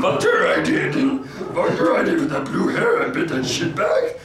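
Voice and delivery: hammed-up Yoda bragging; laughing Yoda impression. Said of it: "Marge Simpson".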